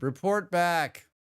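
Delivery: slow, drawn-out command; slow, mock-solemn report back; mock solemn order. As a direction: say it like a grave order. Echoing a chat message slowly.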